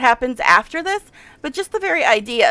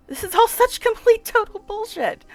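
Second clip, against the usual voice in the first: much higher-pitched exasperated protest; indignant outburst; 9 semitones higher than usual. Real, not acted. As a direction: fume at the unfair verdict. Outraged at the false charges.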